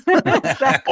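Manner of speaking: laughing. Real, not acted. Laughing at the British scream.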